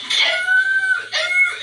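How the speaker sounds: very high mock dog howl; imitated hound screaming. Telling how the dog screamed at something, imitated howl. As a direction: mimic a hound's shrill scream. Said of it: "Silas started screaming".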